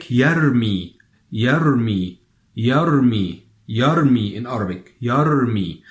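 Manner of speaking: YARMI with a rolled Arabic r before mi; trilled Ra. Shown as a demonstration. Teacher drills the verb throw, trilled r in yarmi. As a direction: roll the r firmly in the middle of YARMI. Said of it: "in order to pronounce this word".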